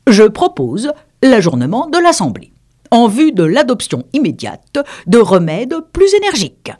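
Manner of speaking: solemn, formal dodo. Acted. The dodo to the assembly, solemn and formal. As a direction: announce like a chairman. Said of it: "d'un air solennel".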